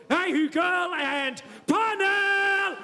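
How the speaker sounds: drawn-out, high announcer call; announcer shout. Real, not acted. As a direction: stretch the act name for the crowd. MC announcing the next act.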